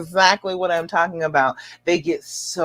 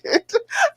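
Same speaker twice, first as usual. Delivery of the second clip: high delighted laugh. Real, not acted. Reacting to viewer praise.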